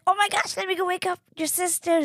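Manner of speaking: imitating a flustered brother-in-law; in-law rushing to wake sister; startled brother-in-law.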